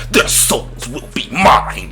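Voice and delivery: slow, low, drawn-out menace; slow mock villain delivery; imitating the old villain line. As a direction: drag it out like a nineties movie villain. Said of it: "The bro was like".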